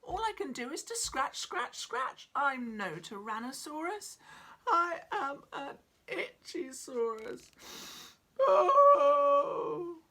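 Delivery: high, loud crying lament; sobbing complaint. Acted. Itchy dinosaur pouring out his misery, sobbing. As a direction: sob it out, miserable. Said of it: "T-Rex began to sob".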